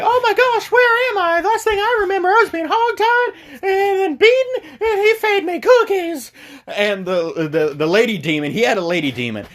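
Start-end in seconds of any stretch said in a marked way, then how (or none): 0.0-6.3 put-on high falsetto girl voice, bewildered; frightened girl. Rescued girl waking up in hell, high falsetto. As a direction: squeak it out like a dazed captive girl. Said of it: "and the girl's like".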